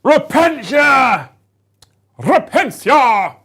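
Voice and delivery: loud, high, long-stretched war cry; mock battle cry. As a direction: bellow the unit name theatrically. Battle cry for her unit.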